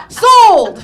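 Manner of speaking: loud, high triumphant sold; exultant shout. Acted. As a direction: slam it like a winning auctioneer. Closing the sale.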